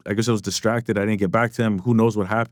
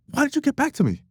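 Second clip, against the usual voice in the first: very high imitated English accent. Label man demanding a reply, high mock English accent. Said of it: "doing his, like, English accent".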